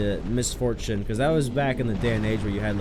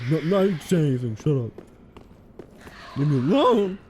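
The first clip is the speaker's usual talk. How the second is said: embarrassed squeak; 7 semitones higher, 5 dB louder than usual. Pretend. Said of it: "You wanna buy me a gun?".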